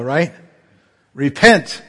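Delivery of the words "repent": louder, ringing call to repent; prophet's warning. Retold or imitated. Jonah warning Nineveh, loud ringing call. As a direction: boom it out like Jonah.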